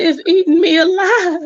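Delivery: high, teary wail broken by laughter; mock crying. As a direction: cry it out while cracking up. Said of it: "that sucker was crying".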